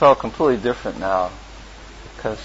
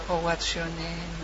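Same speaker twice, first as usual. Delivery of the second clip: softly imitating a gentle devotee; a light, hushed take on the saintly monk; 6 semitones higher, 9 dB quieter than usual. Imitating the gentle cook asking his name, soft and hushed. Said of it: "he talked like this".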